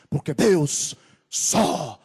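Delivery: loud, high shout of praise to God; full-force preaching. Real, not acted. Preacher proclaiming the one Almighty God to the church, full-force shout. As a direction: belt it out, building to a sustained shout.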